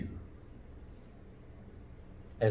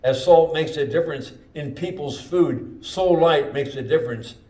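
louder, rising sermon climax; closing sermon build, loud and higher; full-force preaching; 3 semitones higher, 5 dB louder than usual. Acted. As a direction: call the church to action.

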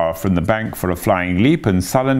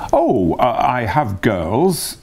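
light; 4 semitones higher, much slower than usual. A narrator's reading. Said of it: "said Toad lightly".

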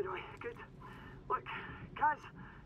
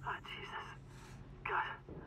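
hushed shock; 7 dB quieter, slower than usual. Acted. Hearing friend died, whispered shock.

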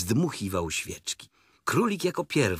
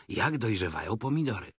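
plain reading voice

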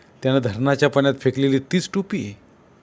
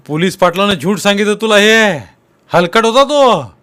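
loud, higher heated protest, last words slowed; angry denial; 5 semitones higher, 7 dB louder than usual. Acted. Sonya denying the police patil's story, loud and angry. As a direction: spit out the insult, furious.

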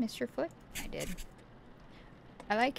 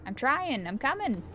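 higher, louder protesting call; calling back; 5 semitones higher, 5 dB louder than usual. Pretend. Player answering Rebecca's summons on the run, loud high call back. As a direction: call out that you are on your way, voice raised.

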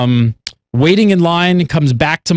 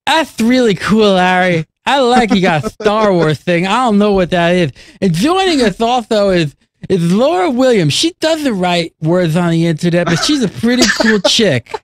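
lisping put-on voice. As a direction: lisp it with the tongue out too far. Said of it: "talk with that lisp".

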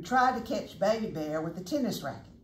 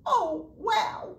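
much higher excited cub shout; a little bear calling out in amazement; Baby Bear hollering; 14 semitones higher, 5 dB louder, much slower than usual. Acted. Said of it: "hollered Baby Bear".

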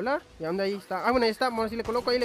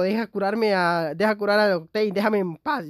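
mock whiny teammate, louder and drawn out; imitando a la Grey; 7 dB louder, slower than usual. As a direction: play the annoyed Grey begging to heal, slowed down. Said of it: "La Grey es como". (imitación burlona de la Grey, más fuerte y lenta)

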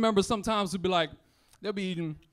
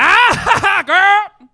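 loud, high hollering auntie cackle; shrieking "Ah, girl!" with a laugh; Aunt Jemima laugh; 10 semitones higher, 15 dB louder than usual. Retold or imitated. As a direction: whoop it out like a big loud auntie. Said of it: "That was that Aunt Jemima".

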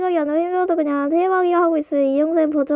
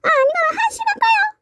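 very high squeaky cry. Acted. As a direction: squeak it out like a cartoon. Squeaky put-on voice.

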